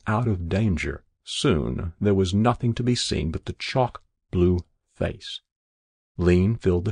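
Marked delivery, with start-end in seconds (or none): none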